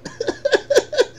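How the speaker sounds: a burst of high, giddy laughter; gleeful high laugh. Real, not acted. After his trade hits.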